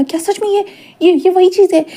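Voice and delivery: high, stunned question at the sight; shocked disbelief. Retold or imitated. Wing gaping at the fancy house, shocked and high. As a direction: gasp it out as if you can't believe your eyes. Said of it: "wing इतना shockingly देख रहा था".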